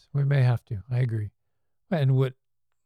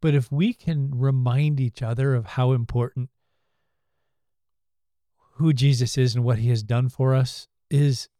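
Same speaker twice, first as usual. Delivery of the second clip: usual speaking voice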